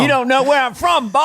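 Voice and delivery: high, taunting Southern twang; Southern accent. Shown as a demonstration. Host taunting the stumped guest, high Southern accent. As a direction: needle him in a Southern accent. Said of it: "go through all the Southern accents".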